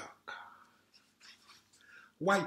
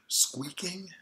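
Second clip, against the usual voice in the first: whispered echo; 5 semitones higher than usual. Acted. Echoing the squeak to the child in a whisper. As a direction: whisper it like a secret.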